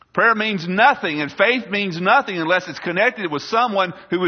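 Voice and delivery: high, loud pulpit proclamation; emphatic preaching. Real, not acted. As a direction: hammer the key words with conviction. Preaches at full force.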